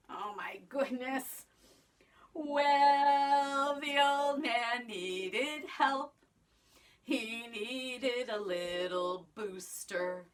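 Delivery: put-on lively sing-song storytelling for kids; reading to children. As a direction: tell it like a picture book to a class. Teacher reads story to children.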